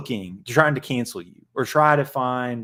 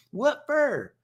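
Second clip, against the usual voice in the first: South Park voice; 12 semitones higher than usual. Retold or imitated. Host finishes South Park gag, high cartoon squawk. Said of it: "he's like South Park".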